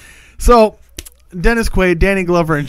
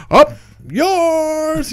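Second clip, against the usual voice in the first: much higher, put-on cocky pilot; mock taunt; 11 semitones higher than usual. Pilot voiced taunting alligator.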